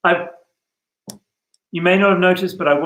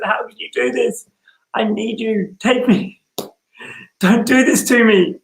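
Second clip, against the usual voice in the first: higher, pleading voice of a wounded lover; passionate plea; 7 semitones higher than usual. Acted. Cristiano begging Antonio to take him, passionate plea. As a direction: beg him with all your heart. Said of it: "sounded like you were expressing your love passionately".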